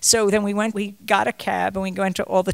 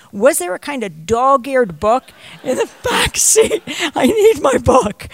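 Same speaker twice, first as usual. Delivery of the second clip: pleading father, much higher and louder; imitating father; 7 semitones higher, 7 dB louder than usual. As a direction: beg for the book back. Father asks police about book.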